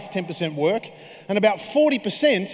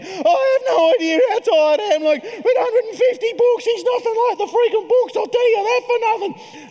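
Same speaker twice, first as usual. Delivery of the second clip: much higher, loud, cracked whine; whinging new dad; 6 dB louder than usual. Retold or imitated. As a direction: complain like an exhausted new dad falling apart. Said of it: "flicked this whinge switch".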